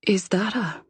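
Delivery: whispered question trailing off; wondering, unfinished whisper; voice fading away. A narrator's reading. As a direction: begin asking in a hush, then fade out unfinished. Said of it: "My voice fades away".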